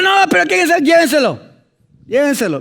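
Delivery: high, loud, outraged command; indignant order. Acted. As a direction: throw him out, scandalized. Portero ordena echar al pecador. (orden indignada y fuerte)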